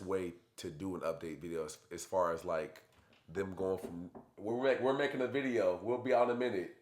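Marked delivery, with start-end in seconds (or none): none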